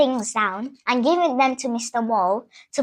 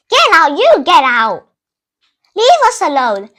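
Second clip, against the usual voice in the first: mole yelling back; 3 semitones higher, 6 dB louder than usual. Retold or imitated. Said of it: "Mister Mole was like".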